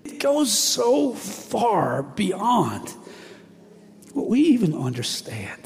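moved, voice softening and slowing; real emotion breaking through; getting emotional. Real, not acted. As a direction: let the feeling catch in the throat. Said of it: "I'm getting emotional".

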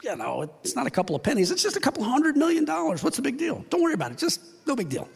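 high, breezy brush-off of a debt; mock careless accountant. Accountant waving off the books, in a mock careless voice. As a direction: shrug off a huge loss like it's nothing. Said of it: "You're not gonna balance a book and go".

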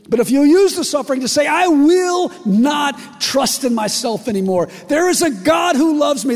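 impassioned pulpit declaration; preaching at full force. Acted. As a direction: proclaim it with fire.

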